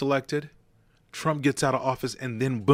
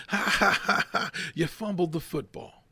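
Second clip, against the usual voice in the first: higher mock taunting laugh; mocking laughter; 7 semitones higher than usual. Relatives in the stands taunting a fumbling freshman, laughing. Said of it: "they're laughing at me".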